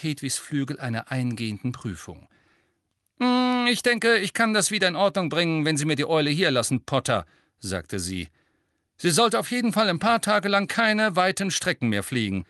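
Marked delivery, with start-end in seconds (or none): 3.2-12.4 high, loud no-nonsense voice; brisk gruff teacher. A narrator's reading. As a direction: bark the question like a gruff vet. (resolute Lehrerin, laut)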